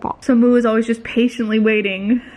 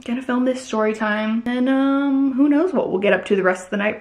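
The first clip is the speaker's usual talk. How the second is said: ordinary talk, nothing marked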